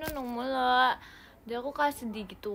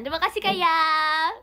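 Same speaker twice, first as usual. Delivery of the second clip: loud gift shout-out; teriakan terima kasih; 3 semitones higher, 9 dB louder than usual. Real, not acted. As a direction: shout thanks, swelling on the name. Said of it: "jadi kita teriakin gitu". (teriak lantang berterima kasih)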